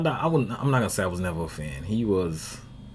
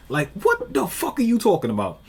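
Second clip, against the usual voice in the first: incredulous outburst; 10 semitones higher than usual. Real, not acted. Ranting at the fighter.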